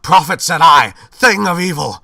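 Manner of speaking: high, loud, frantic cry; frantic shout. Acted. Narrator screaming at the raven, high and loud. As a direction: shout it at the bird at full pitch.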